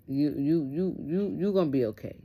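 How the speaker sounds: imitating an officer carefully comforting someone; retold stammered reassurance. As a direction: stumble on "you", then insist kindly.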